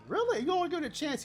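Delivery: very high-pitched incredulous question; retold disbelief. Retold disbelief at Marco's refusal.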